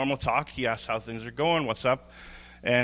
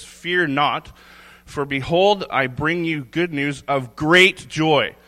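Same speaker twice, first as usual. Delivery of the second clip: 1 scripture reading; 5 dB louder, slower than usual. Acted.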